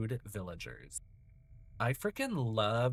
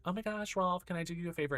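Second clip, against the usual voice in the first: higher, fast, overly sweet; sweet friend voice; 8 semitones higher, faster than usual. Retold or imitated. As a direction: gush it like a people-pleaser. Friend voiced talking to Rolf.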